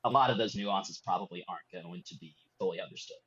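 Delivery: normal talking voice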